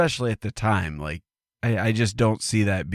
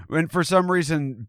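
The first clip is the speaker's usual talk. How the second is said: ordinary delivery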